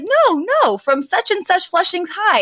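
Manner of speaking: mock eager, pitched high; eager insisting. Fran retold, pressing the woman, lightly high and eager. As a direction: insist brightly she knows her. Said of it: "she's like".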